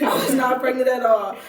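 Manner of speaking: loud, insistent protest; emphatic denial. Real, not acted. Denying being pregnant to the viewers, loud and insistent. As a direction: shout it down, no way.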